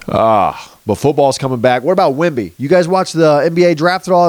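ordinary delivery

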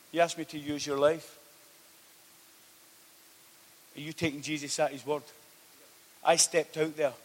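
loud, driving sermon to the congregation; preaching at full force. Acted. Preacher urging the church to take Jesus at his word, loud and driving. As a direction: press every line home like a revival preacher.

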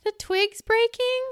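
high, slow, loud mock question; half-asleep friend imitation. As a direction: imitate a sleepy friend asking, drawn out high. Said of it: "she's half asleep. She's like".

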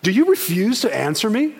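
voiced Pilate losing patience; frustrated Pilate. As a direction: press him like a frustrated governor. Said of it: "gets so frustrated".

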